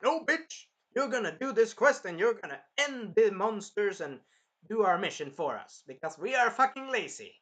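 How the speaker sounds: high, loud, bratty refusal; imitating a rude king; mocking king shout. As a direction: yell it like a lazy tyrant.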